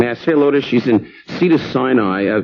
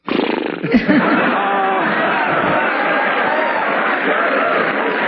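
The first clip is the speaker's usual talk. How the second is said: a long wheezy hammed-up laugh; mock wife's laugh; 6 semitones higher than usual. Comedian imitating his wife getting up, mock laugh. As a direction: cackle it out like a silly wife. Wife's morning noise, acted.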